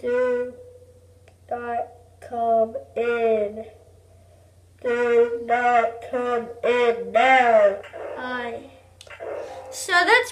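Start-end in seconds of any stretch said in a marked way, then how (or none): 0.0-8.6 slow, lower voice-changer toy; morphing voice. Shown as a demonstration. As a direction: speak through a toy voice changer. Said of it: "it changes your voice".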